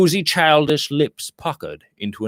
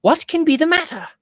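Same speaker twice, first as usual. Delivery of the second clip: much higher, alarmed; frightened question. A narrator's reading. Lady Audley to her husband over the telegram, frightened high question. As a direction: ask it half afraid, voice shooting up.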